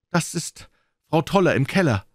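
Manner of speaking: high, eager woman's voice; young governess, excited and keen. A narrator's reading. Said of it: "sagte sie".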